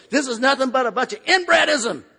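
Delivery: retold ranting yell. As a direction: rave it like the angry man, laughing. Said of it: "He starts ranting and raving".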